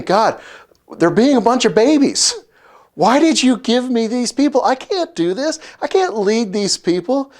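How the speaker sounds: high-pitched, hammed-up complaint to God; exasperated Moses. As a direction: imitate someone at the end of their rope. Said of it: "Moses gets angry".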